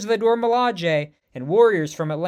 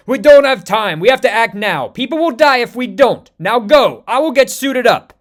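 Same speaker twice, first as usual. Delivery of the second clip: loud, higher urgent orders; urgent royal command; 5 semitones higher, 10 dB louder than usual. Acted. King ordering troops to deploy, loud and urgent. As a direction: push the soldiers out fast, forcefully.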